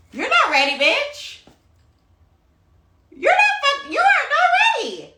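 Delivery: very high squealed self-scolding; mock self-rebuke. Passenger scolding herself all flight, high mock squeal. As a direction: shoot up into a comic high squeal.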